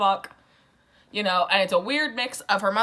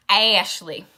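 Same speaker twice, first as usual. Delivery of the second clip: louder, put-on Southern accent on Ashley; 5 dB louder than usual. Shown as a demonstration. Showing listeners how the name is said, Southern accent. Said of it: "You gotta say it with that Southern accent".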